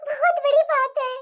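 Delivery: tearful, sobbing admission to a husband; crying confession. Acted. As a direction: confess and plead through sobs. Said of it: "तू रो क्यों रही है". (रोते हुए, सिसककर)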